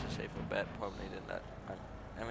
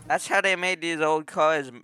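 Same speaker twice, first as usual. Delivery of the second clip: excited; 6 semitones higher, 9 dB louder than usual. Real, not acted. Excited claim about old cars.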